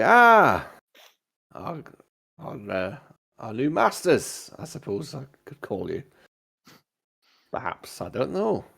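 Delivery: captain greeting new crew, lively rising swoops; lightly voiced captain, unsure what to call them; ship captain. Game master plays the captain.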